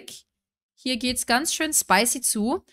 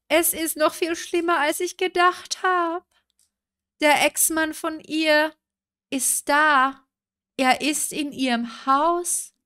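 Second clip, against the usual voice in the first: schockiert; 6 semitones higher, slower than usual. Real, not acted. Ex-Mann plötzlich im Haus.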